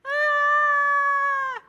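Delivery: long high piercing scream; roller coaster scream. Retold or imitated. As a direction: scream like riders dropping down the hill.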